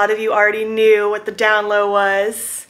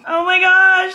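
high-pitched overwhelmed outburst; excited squeal; 7 semitones higher, 4 dB louder, slower than usual. Real, not acted. Vlogger reading messages after launch, excited squeal. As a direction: burst out high and thrilled.

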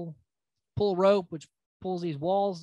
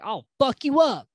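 mock threat yell; 8 semitones higher, 7 dB louder than usual. Back and forth, act like fighting.

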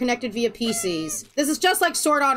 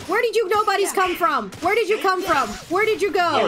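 video game hero; 7 semitones higher than usual. Acted. Game character attacked.

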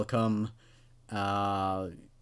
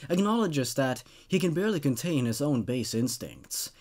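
low, hushed horror narration; measured, brooding storyteller's read; story narration; 3 semitones lower, 4 dB quieter than usual. A narrator's reading. Reads creepypasta story aloud.

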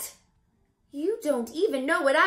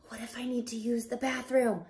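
fast whisper; hushed, rushed fret; whispered worry; 4 semitones lower, 6 dB quieter, faster than usual. Acted. Worried whisper in chrysalis.

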